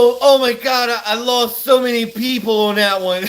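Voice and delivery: ordinary talk, nothing marked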